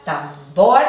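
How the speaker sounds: "tambor" with BOR punched out loud; stress on last syllable. Shown as a demonstration. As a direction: hit the final syllable of "tambor", tam-BOR. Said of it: "es la sílaba acentuada". ("tambor" recalcando la última sílaba, BOR)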